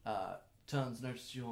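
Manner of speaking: normal talking voice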